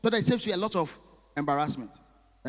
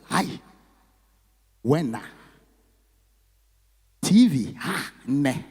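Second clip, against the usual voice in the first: mock South African flirt; 6 dB louder, much slower than usual. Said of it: "I was like".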